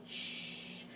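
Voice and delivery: long teacher's shush; hushing shh. Shown as a demonstration. Teacher repeats what bossy R tells vowels, shushing.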